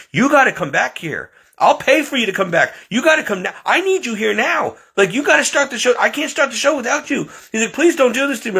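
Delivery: imitating a frantic boss begging; desperate pleading. Boss begging him to return.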